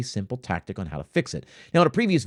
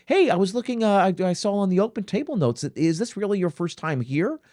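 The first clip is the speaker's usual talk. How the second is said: friendly server check; 8 semitones higher than usual. Shown as a demonstration. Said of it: "server confirms first visit".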